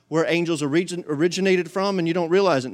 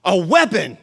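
mock naive thought; 9 semitones higher, 5 dB louder than usual. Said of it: "we thought".

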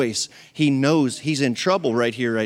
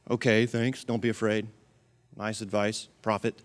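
sarcastic; 5 semitones lower, 7 dB quieter than usual. Pretend. Sarcastic reply to prophet.

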